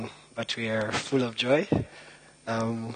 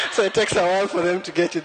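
laughing; 6 semitones higher, 4 dB louder, faster than usual. Real, not acted. Laughing after joke falls flat.

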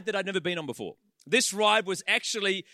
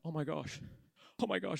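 awed hush; 3 semitones lower, 9 dB quieter than usual. Retold or imitated. Awed by 3D butterfly.

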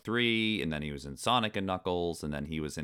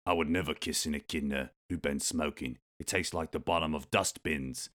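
Idris Elba as Knuckles. Acted. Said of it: "then I'll go into Knuckles".

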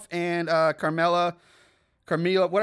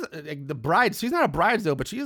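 usual speaking voice

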